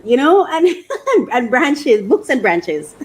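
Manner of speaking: giggling through the words; giddy laughter describing books and branches; laughing. Real, not acted. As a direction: laugh while you picture it. Laughing about a dream library.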